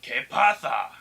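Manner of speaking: put-on Mexican accent. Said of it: "a Mexican man and a pirate".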